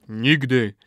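loud, falling one-word vow; ritual response. A narrator's reading. Brothers answer the old man, firm vow. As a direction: swear it firmly.

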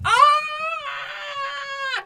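long high scream of pain; loud, sustained high shriek, acted. Giant with his finger bitten off, screaming. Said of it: "Cuchulainn screams".

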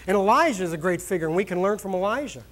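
ordinary talk, nothing marked

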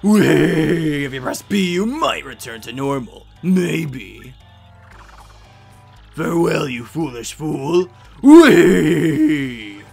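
loud, drawn-out villain send-off; hammy farewell with long gleeful cries; gleeful curse-giver. Acted. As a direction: crow it like a game villain. Same character bids farewell, wheeing.

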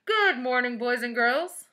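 loud, high, booming greeting; principal's PA announcement. A narrator's reading. Principal to pupils over the PA, loud announcement. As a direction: project it like a school announcement. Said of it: "voice came over the P A system".

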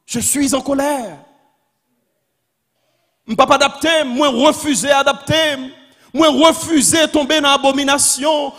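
loud, high, impassioned sermon; full-force preaching. Real, not acted. Preacher declaring holy anger against injustice, full-force preaching. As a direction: hammer out each declaration like a rallying cry.

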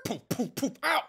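high cartoon bump sounds ending in ow; mock crash and yelp. Chariot tumbling over the ox god, high bump sounds.

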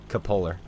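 "cupolar" said cuh-POH-ler; friend's pronunciation. Retold or imitated. Quoting an Idaho friend, "cupolar" as cuh-POH-ler. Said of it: "Idaho friend says".

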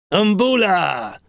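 high, drawn-out greeting call. Acted. Uncle Villy arriving along the path, calling out.

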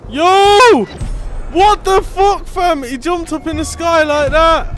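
high, loud startled shout; shocked yell. Real, not acted. Gamer shocked as the demon jumps into the sky. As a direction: yelp in disbelief at the leap.

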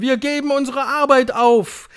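high, loud pledge; shouting crowd. A narrator's reading. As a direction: yell it with zeal. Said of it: "schrien sie durcheinander". (schreiende Menge, hoch und laut)

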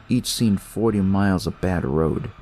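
usual speaking voice

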